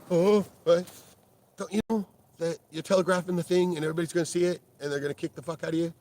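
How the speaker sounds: loud, high, sneering put-on voice; mocking. Taunting his brother about telegraphed punches, loud mocking voice. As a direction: ridicule the clumsy fighter out loud.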